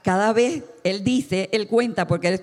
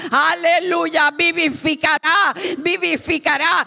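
slow, ringing shouted proclamation; impassioned preaching; 8 semitones higher, 5 dB louder, slower than usual. Real, not acted. Preacher exclaims aleluya to the congregation, impassioned preaching. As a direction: hold out each word with raised fervour.

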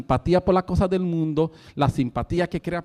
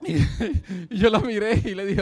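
higher voice with laughter running through it; still laughing; 4 semitones higher than usual. Real, not acted. Preacher telling how he looked at her, laughing. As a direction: recover from a laughing fit while talking.

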